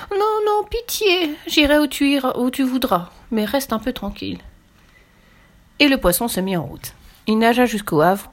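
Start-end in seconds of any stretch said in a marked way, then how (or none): 0.1-4.4 very high, begging, easing down at the end; pleading shark. A narrator's reading. Beaten shark pleading with the doll, high desperate begging. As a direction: plead for mercy, then calm down.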